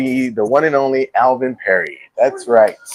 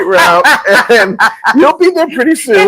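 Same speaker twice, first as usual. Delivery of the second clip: imitating daughter; 7 semitones higher than usual. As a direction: cheer him on like a kind teen. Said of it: "She goes".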